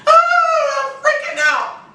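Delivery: high, squeaky mock panic. A listener panicking to the host, high mock squeal. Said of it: "I know you're like".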